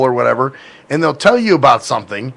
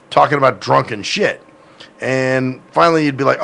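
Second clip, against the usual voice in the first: usual speaking voice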